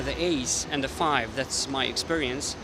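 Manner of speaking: usual speaking voice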